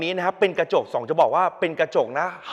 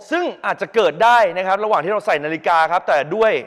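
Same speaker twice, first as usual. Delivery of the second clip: usual speaking voice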